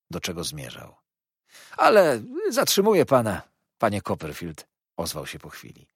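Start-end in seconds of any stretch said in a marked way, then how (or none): 1.8-4.7 high, loud opening, then dropping; fawning Heep. Acted. Heep excusing himself for keeping his guest, fawning. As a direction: apologise obsequiously.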